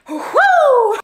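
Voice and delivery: high, loud whoop of mock relief; excited whoop. Real, not acted. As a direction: let out a big giddy cheer. Excited yell after laughing.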